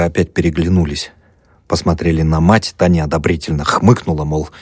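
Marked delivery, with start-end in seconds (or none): none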